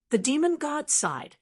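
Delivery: ordinary delivery, nothing marked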